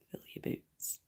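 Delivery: soft whispered welcome; whispered fill your boots. Real, not acted. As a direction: breathe it to the chat. Greeting viewers in chat.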